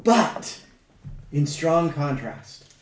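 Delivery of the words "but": "But," punched high and loud; emphatic but. Shown as a demonstration. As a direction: hit "But," hard as a sharp contrast. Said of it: "We have the emphatic "but".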